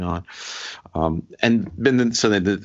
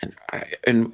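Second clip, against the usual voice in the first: ordinary talk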